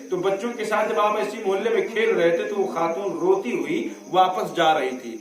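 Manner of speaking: usual speaking voice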